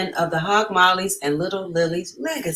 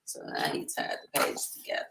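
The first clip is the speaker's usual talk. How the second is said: whisper; 8 dB quieter than usual. Real, not acted. Whispered aside during story.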